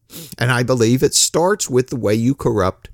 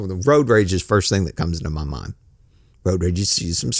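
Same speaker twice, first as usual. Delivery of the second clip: usual speaking voice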